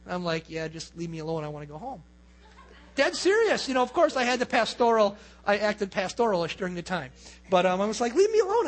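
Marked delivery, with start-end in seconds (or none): 0.5-2.0 low, subdued grumble; reluctant brush-off. Retold or imitated. Pastor answering the lady he didn't like, subdued brush-off. 8.1-8.7 high, louder acted protest; annoyed rebuff. Young pastor to the mean old lady, annoyed high protest.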